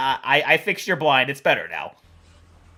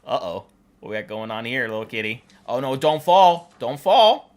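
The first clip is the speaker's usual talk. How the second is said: usual speaking voice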